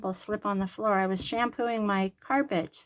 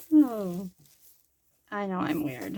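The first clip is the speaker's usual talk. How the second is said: usual speaking voice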